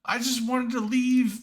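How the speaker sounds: high, exasperated whine. Real, not acted. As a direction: complain in disbelief. Frustrated laughing complaint.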